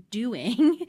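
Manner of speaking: "doing" drawn out and set apart in air quotes; air-quoted DOING. Real, not acted. Podcast coach flagging a so-called task, air-quoted stress on "doing". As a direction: stretch "doing" with a wry, quote-marked emphasis. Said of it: "I have air quotes".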